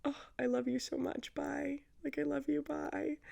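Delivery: tender farewell. Retold or imitated. Tender goodbye at breakup end.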